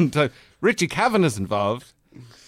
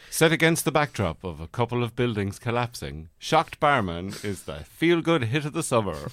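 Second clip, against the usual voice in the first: mock movie trailer voiceover; 3 semitones lower, 3 dB quieter than usual.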